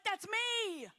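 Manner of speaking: loud, high, impassioned preaching; God preaching through her. Retold or imitated. God confronting her buried pain, preached loud and urgent. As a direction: proclaim it as God pleading and urging. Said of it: "And he's like".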